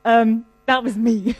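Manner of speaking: mock-sheepish slow admission; embarrassed admission. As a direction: confess it slowly, as if embarrassed. Man admits he was the screamer.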